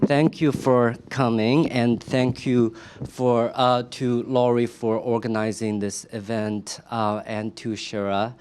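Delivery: usual speaking voice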